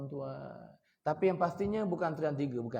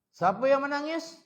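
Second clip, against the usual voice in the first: calling out; 12 semitones higher, 10 dB louder than usual. Acted. Prophet Musa searching for the crying.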